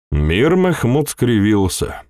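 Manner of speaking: ordinary delivery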